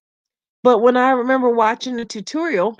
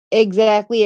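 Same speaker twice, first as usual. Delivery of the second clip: ordinary talk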